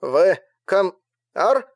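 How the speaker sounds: disbelieving voice breaking up on radio; crackly cordless phone. A narrator's reading. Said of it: "недоверчивый голос".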